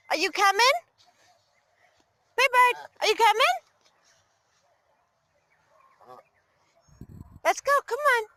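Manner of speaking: rising high coaxing questions; coaxing call. Real, not acted. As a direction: coax the bird to come along.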